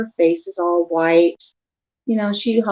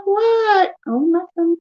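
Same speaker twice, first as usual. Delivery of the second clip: high innocent voice; 10 semitones higher, much slower than usual. Retold or imitated. Retold quarrel with husband.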